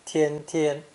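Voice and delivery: both syllables of "Tian tian" high and level; high flat first tone. Shown as a demonstration. Teacher doubling the character for emphasis, "Tian tian" high and flat. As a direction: keep "Tian tian" on one high, even pitch. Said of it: "pronounced with a high and flat first tone".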